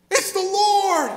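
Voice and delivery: recognize him and cry out in wonder; slow, high, loud cry of amazement; astonished cry. Acted. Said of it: "the disciple whom Jesus loved".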